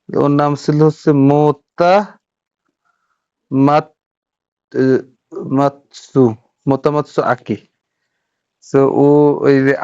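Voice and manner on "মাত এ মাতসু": normal talking voice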